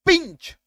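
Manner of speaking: पिंच with a short "ih", pitched high. Shown as a demonstration. Teacher applying the rule, high loud पिंच with short i. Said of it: "ई हो जाएगा".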